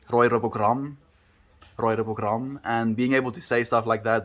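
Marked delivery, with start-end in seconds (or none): 0.1-2.6 Roy Robogram with every R trilled; rolled R's. Shown as a demonstration.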